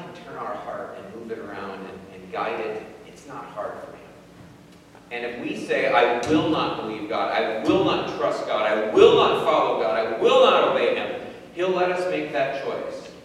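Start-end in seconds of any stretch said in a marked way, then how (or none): 5.9-11.2 emphatic refusals, growing louder; stubborn, forceful declarations; defiant refusal. Acted. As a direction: hit each "will not" harder, building in volume. Acting out defiant refusal of God.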